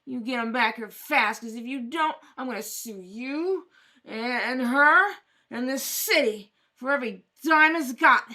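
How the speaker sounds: bang each threat home; loud furious threats of a lawsuit; angry threat. Acted. Threatens to sue the city.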